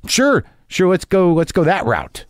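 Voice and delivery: high sarcastic sure, sure; mock eager, pitch jumping up; sarcastic agreement. Caller mocking the agent's offer, high sarcastic agreement.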